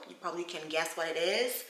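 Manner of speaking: ordinary talk, nothing marked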